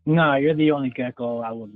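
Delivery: ordinary talk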